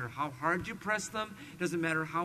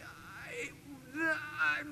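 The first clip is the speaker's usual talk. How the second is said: choking up; 9 semitones higher, 5 dB quieter than usual. Retold or imitated. Brother choked up on stage.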